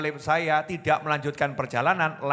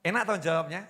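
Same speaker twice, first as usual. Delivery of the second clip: normal talking voice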